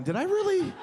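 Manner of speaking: lightly voiced confused detective; hungover Columbo. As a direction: puzzle it out like a groggy detective. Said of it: "You're like a hungover Columbo going".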